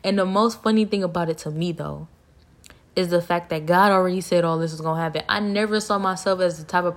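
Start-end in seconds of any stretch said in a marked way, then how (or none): none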